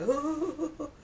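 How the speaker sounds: high mock-alarmed "ooh"; wobbly panic noise. Acting out wobbling on the ladder, mock alarm. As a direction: wail as if teetering on a ladder. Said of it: "because I'm wobbly".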